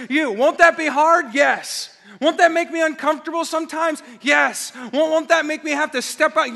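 loud, building sermon proclamation; preaching at full force. Acted. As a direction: hammer each all home to the church. Acts out disciples, full force.

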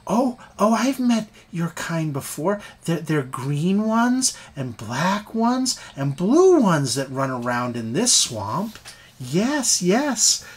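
high, gleeful dragon chatter; gleeful little dragon. Acted. Pseudo dragon delights in meeting a kobold, high and gleeful. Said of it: "Bims dances on your shoulder and says".

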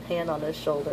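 usual speaking voice

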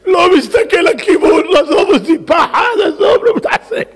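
high-pitched comic panic; mock panic. Man acting out his fear of looking anywhere but the sea, high mock panic. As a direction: refuse in a squealing, hammed-up fright. Acted panicked refusal to look.

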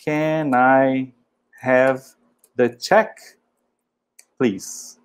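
Can I, have, the check, please with pauses between; Can I have the check please, palavra por palavra; word by word. Shown as a demonstration. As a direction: split it into slow separate words. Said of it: "Assim, ó".

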